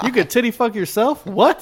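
high, loud incredulous yelp; shocked outburst. Real, not acted. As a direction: blurt it out in disbelief. Outraged disbelief at the idea.

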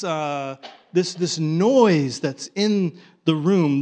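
normal talking voice

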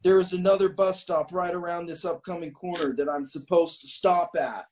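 lightly acted shouted announcement; driver yelling back. A narrator's reading. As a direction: call back to a passenger. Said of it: "the bus driver yells back toward me".

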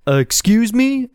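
high, slow called-out query; mild mock shout. A narrator's reading. Jay interrupting the neighbour, mild high-pitched shout. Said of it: "Jay shouted".

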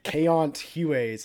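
Kent Hughes said the French way, like eɪɑnt hjueɪz; French Canadian accent. Retold or imitated. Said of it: "added accents to both the E's".

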